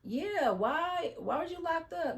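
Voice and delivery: high, lightly voiced little girl asking; little girl checking him; curious child. Child questions the new boyfriend.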